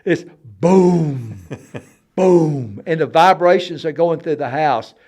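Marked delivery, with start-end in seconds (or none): 0.6-2.8 loud, ringing drawn-out booms; imitated heavy boom. Describing buffalo-heavy attic noise, loud imitated booms. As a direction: boom like something huge landing overhead. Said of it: "like a giant water buffalo".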